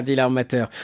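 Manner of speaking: ordinary delivery, nothing marked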